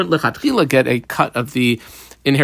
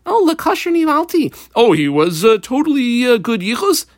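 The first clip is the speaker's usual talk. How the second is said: much higher, eager mock woman; mock woman's answer; 9 semitones higher than usual. Pregnant woman assures the judges about the father, high and eager. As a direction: chirp back a proud, eager answer.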